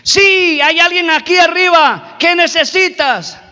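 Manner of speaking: high, projected answer from above. Retold or imitated. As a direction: call down to a stranded climber. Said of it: "hasta que escuchó una voz".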